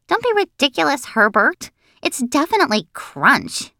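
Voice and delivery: high-starting, amused dismissal; lightly mocking kid certainty; scoffing. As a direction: brush off the correction confidently. Narrator laughingly dismisses Herbert's correction.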